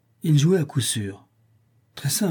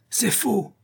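high, sharp yelp; yelped denial; 10 semitones higher than usual. Acted. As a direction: yelp it out in panic. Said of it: "glapit Helbridge". (glapissement aigu, paniqué)

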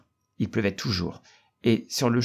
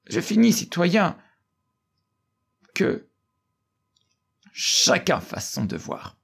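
rousing orator; 5 semitones higher than usual. A narrator's reading. Orator's closing appeal to citizens.

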